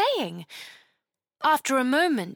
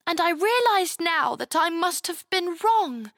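put-on higher girl voice, regretful; sadly; 8 semitones higher than usual. Chloe admitting her mistake, regretful. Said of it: "Chloe said sadly".

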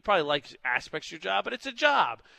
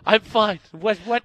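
flustered cover-up; 4 semitones higher, 8 dB louder, slower than usual. Retold or imitated. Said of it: "I do one of those".

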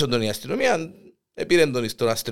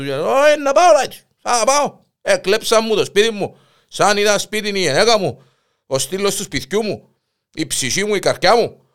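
insistent outburst; 6 semitones higher, 5 dB louder than usual. Retold or imitated. Husband insists loudly on police.

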